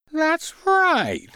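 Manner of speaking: gleeful duck. Acted. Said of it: "clucked the duck as he chuckled with glee".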